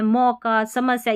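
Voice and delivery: normal talking voice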